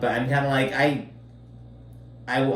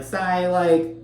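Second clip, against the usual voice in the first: mock incredulous; 7 semitones higher, 5 dB quieter, slower than usual. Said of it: "I'm like".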